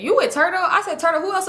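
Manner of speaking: retold high-pitched accusing question; mock outraged questioning; indignant confrontation. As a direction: pitch it up sharply, confronting him.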